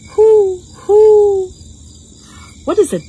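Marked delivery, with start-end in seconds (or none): 0.1-1.5 high, loud owl hoots; two long, high hoots. Shown as a demonstration. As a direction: hoot like an owl for toddlers. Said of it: "What does an owl say?".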